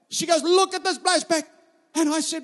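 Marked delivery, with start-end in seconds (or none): 0.4-1.4 put-on high, loud Bulgarian-accented complaint; imitated Bulgarian wife, pitched up and insistent. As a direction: point out the flaw in a mock Bulgarian accent. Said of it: "in her little Bulgarian accent".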